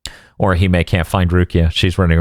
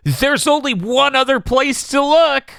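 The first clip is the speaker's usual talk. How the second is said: mock dramatic line; slower than usual. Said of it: "And then they go".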